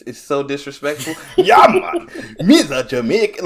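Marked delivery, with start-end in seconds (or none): 1.4-3.4 put-on very high, loud caricature Jamaican accent; hammed-up movie Jamaican, laughing as it bursts out; bad Jamaican accent. Co-host mocking movies' Jamaican accents, bad Jamaican accent. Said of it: "track record with Jamaican accents".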